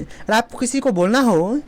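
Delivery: ordinary talk, nothing marked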